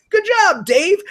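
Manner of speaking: high, loud fake-proud pat on the back; mock proud praise. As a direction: praise him like a doting sidekick. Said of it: "he was like".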